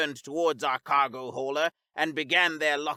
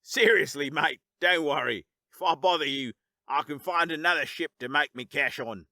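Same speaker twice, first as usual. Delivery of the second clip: Aussie bloke. Acted. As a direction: reassure him, Australian. Cat's Aussie voice in story.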